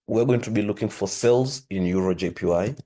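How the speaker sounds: ordinary talk, nothing marked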